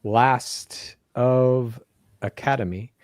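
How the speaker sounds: usual speaking voice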